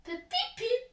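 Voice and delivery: very high whistle peeps; little engine peeps. A narrator's reading. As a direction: imitate a small train's whistle. Thomas's whistle imitated.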